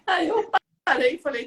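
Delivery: normal talking voice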